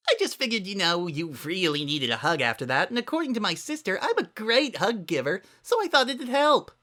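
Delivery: put-on bouncy party girl voice; bubbly Pinkie.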